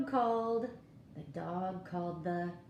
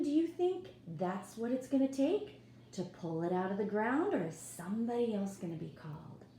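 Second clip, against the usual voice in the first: ordinary delivery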